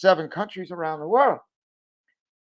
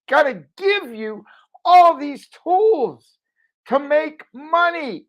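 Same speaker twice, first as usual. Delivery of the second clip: excited pitch; 10 semitones higher, 5 dB louder, slower than usual. Real, not acted. Pitching his program excitedly.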